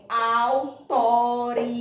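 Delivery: "autoridades" slowly split into five syllables. Shown as a demonstration. Teacher counting syllables for pupils, "autoridades" drawn out in pieces. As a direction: stretch out au, to, ri, da, des in "autoridades". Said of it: "Como é que é realizada a separação silábica".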